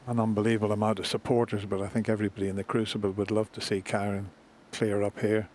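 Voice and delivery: ordinary talk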